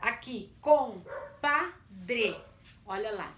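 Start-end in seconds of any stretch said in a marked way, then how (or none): 0.6-2.4 "compadre" in separate, louder syllables. Shown as a demonstration. Teacher introduces a word to split, "compadre" syllable by syllable. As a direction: break "compadre" at every syllable. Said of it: "vamos separar essas sílabas".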